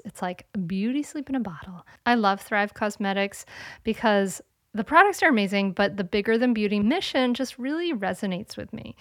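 upbeat podcast ad read. Acted. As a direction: sell it like a sponsor spot. Reads a sponsored ad.

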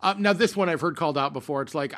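usual speaking voice

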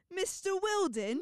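imitating her British accent. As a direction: draw out Wilden British style. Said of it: "because of how you say it".

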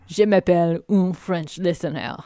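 mock French through a laugh; hammy cartoon Frenchman; French accent. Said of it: "your French listenership".

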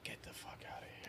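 stunned whisper. Real, not acted. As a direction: breathe it out in disbelief. Disbelief at buddy character.

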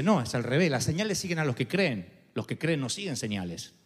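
low, firm divine reply; a hint of a deep God voice. God answers the believer.

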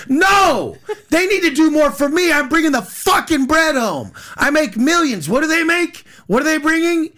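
high, loud, worked-up retort; indignant rebuttal. Acted. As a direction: fire back as if insulted by the advice. Arguing back at imagined advice.

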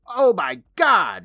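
high, loud, drawn-out exclamation; hammed-up cry of horror, stretched out slow; acted shock. Retelling Billy spotting the injection, hammed-up shocked cry. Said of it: "Billy looks over saying".

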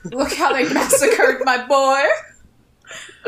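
loud, slow, laughing mock grief; hammy wailing lament. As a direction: wail it like a movie mobster. Famous wailing movie quote.

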